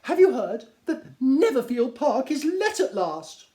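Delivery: shrill-voiced Mrs Bennet. Wife calling to her husband, high and gushing. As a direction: gush it like a fussy excited wife. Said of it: "said his lady".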